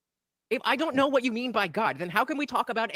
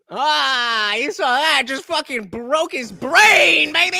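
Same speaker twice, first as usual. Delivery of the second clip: gleeful gloating; 3 semitones higher, 8 dB louder, much slower than usual. Real, not acted. Gloating over debate clip.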